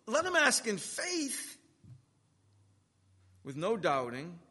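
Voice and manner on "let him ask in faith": high, slow, weighty reading of the verse; emphatic verse reading. Acted. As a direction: lean on every word of the verse. Preacher reading verse six.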